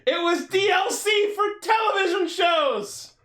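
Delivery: high, loud incredulous yell; gleeful shout. Real, not acted. Joking to co-host about paid theatrical episodes, shouting. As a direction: shout the punchline through amusement. Mock outraged exclamation while laughing.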